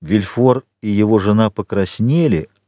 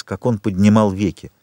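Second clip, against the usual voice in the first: usual speaking voice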